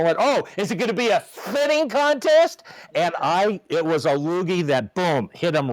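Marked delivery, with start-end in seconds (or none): 0.2-2.6 high, worked-up comeback; indignant, raised-pitch retort in a retold row; heated retort. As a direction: re-enact a heated challenge back. Acting out his screaming argument.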